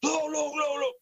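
high frightened cry, repeated; panicked alarm. Retold or imitated. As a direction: point and gasp in terror. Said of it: "he absolutely freaks out".

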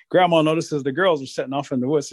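usual speaking voice

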